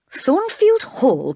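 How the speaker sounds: a stunned, drawn-out question; astonished cry. A narrator's reading. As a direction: echo it back in disbelief. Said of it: "cried the priest".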